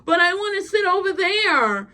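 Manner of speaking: high-pitched mock pleading. Retelling her asking to sit over there, high mock plea. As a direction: imitate a clingy woman pleading.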